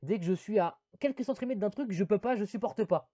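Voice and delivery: hint of a smug older brother; Chuck's answer. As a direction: play the brother too sure of himself. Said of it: "Et Chuck fait".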